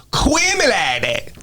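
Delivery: "Quit me like that" high, slow and slurred; "Quit me like that" as high-pitched mocking rap imitation; mock garbled English. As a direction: drag "Quit me like that" into a mangled blur. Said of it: "you fucking up the language".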